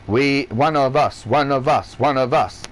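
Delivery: low, repetitive robot-like chant; mock robot. Speaker mocks people with no freedom as robots, lightly robotic chant. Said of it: "They are all robots".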